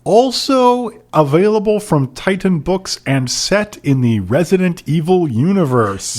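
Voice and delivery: announcer selling the book series; mock announcer. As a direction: read it like a promo, high and stretched. Reads out quiz question.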